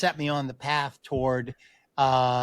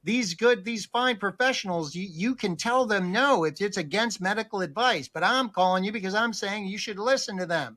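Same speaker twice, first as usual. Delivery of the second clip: higher-pitched imitation of a nurse; nurse on phone; 5 semitones higher than usual. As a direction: reason with a stubborn patient as the nurse. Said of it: "nurse on the phone".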